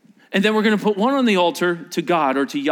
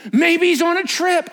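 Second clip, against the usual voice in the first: mocking; 12 semitones higher, 3 dB louder than usual. Elijah mocks again.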